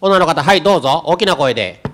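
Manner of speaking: loudly calling on the audience. Real, not acted. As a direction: call across the hall. Moderator calling audience member. (司会が会場に大きな声で呼びかける)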